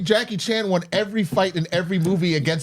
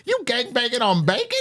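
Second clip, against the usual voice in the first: higher, incredulous joke delivery; comedian impression; 6 semitones higher than usual. Retelling a Cat Williams joke to friends, comedian impression. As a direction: ask it in mock disbelief, pitched up. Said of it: "he'd literally just be like".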